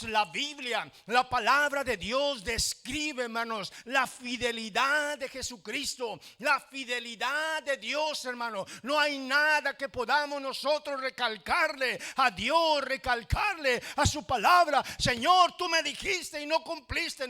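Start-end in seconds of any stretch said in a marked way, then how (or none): 4.1-14.8 louder, higher, driving the point home; fervent preaching. Acted. Pastor preaching, voice raised. 15.0-17.3 high, loud reproach at God; complaining believer. Acted.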